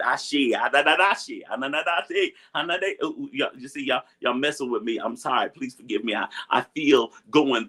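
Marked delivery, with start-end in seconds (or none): 0.5-3.8 overcome stammer. Real, not acted. Overwhelmed mid-sermon, words break down.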